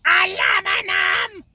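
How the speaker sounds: "Aluminum" shouted high and slow; American pronunciation. Acted. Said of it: "you're saying that quite right".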